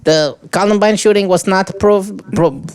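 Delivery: high, slow cartoon Mexican accent; a hammed-up Speedy Gonzalez take. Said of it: "your best Speedy Gonzalez voice".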